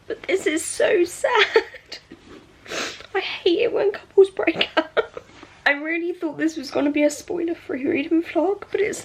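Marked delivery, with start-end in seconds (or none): 0.1-7.1 fighting back tears. Real, not acted. Reader upset over a book breakup, fighting back tears. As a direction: hold back real tears while forcing the words out. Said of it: "I'm desperately trying not to cry".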